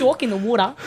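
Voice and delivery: laughing mock Australian accent; playful Australian accent. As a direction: call it out in a jokey Aussie twang. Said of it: "quite like the Australian accent".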